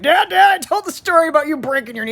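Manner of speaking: high, loud, quick teasing call. Retold or imitated. Son telling dad on the phone about the story, loud teasing. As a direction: needle him on the phone. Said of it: "I'm like".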